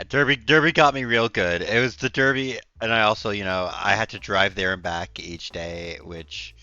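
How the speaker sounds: usual speaking voice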